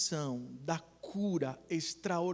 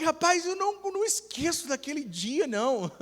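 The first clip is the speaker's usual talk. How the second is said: high, loud, emphatic exclamation; comic protest; 10 semitones higher, 5 dB louder than usual. Retold or imitated. Friend swears he remembers that day, high comic protest. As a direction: protest it high, easing down at the end.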